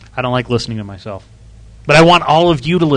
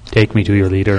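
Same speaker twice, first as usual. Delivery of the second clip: low, commanding invader voice; alien overlord; 6 semitones lower than usual. Pretend. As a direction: demand it like a sci-fi conqueror. Classic alien line amid voice effects.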